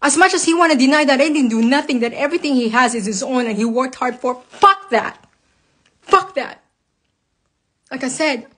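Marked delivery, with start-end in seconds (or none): 4.5-6.6 angry outburst. Real, not acted. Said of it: "I'm fucking very angry".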